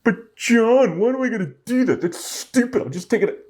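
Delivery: high, fast imitation of a skeptical viewer; whiny viewer objection. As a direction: act out a lazy viewer talking back. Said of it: "And go and do it".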